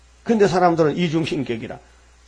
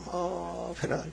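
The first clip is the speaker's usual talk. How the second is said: normal talking voice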